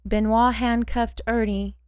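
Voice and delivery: ordinary delivery, nothing marked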